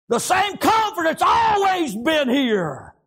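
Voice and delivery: high, drawn-out pulpit cry; impassioned revival shout; shouted preaching. Real, not acted. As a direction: sing out the words slowly and high, falling at the end. Preacher shouts, then repeats it quietly.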